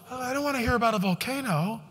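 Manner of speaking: mock a pampered latte drinker; hushed sneering imitation of a complainer; mocking snowflake. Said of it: "the snowflakes don't wanna hear it".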